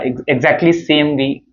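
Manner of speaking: normal talking voice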